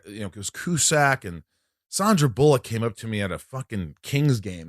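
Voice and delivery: usual speaking voice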